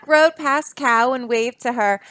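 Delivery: plain reading voice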